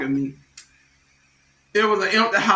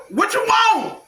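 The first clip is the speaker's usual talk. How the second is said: yelling at a stranger; 14 semitones higher than usual. Retold or imitated.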